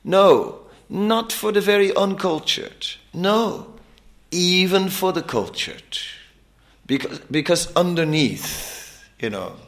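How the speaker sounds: high, ringing preacher's climax; preaching. Acted. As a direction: build it up like a fiery sermon.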